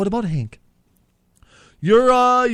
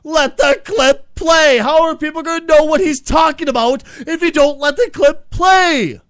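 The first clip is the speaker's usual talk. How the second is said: slow, loud, higher yell; frustrated shout; 8 semitones higher, 6 dB louder, slower than usual. Real, not acted. Co-host yells at the host to stop interrupting the clip, angry shout. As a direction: bellow at the host to let it play.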